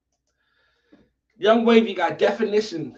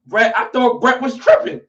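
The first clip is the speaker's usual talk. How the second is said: loud, high, worked-up rant; heated rant; 7 semitones higher, 6 dB louder than usual. Real, not acted. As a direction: vent at the odd vote. Ranting about Brett's vote.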